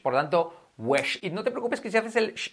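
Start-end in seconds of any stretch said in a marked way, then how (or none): 0.8-1.2 "wesh" with short e and a strong final sh; exaggerated sh. Shown as a demonstration. Teacher models wish for Spanish learners, exaggerated sh. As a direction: lean into the hush ending "wesh". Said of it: "y bien exagerado".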